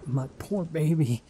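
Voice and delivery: drawn out like a breaking heart; grieving. Acted. Mother over her dying son, slow and grief-stricken.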